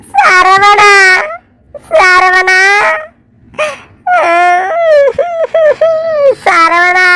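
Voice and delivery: name called out, breaking into a sob; tearful calling. Acted. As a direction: plead for Saravana as the crying takes over. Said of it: "ஏன் அழுவுற?".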